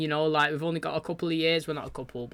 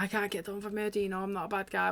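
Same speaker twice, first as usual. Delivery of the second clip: panicky plea; 3 semitones higher, 6 dB quieter than usual. Retold or imitated. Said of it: "He was panicking".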